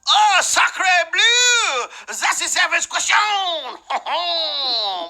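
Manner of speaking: over-the-top French chef; French accent. Acted. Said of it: "That wasn't even French after a while".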